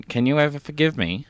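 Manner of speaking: ordinary delivery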